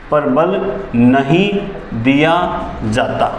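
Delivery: very slow, word by word; slow dictation. Shown as a demonstration. Teacher dictating the note to students, very slow. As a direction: lay each word down as if writing it on the board.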